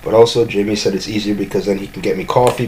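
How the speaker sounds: usual speaking voice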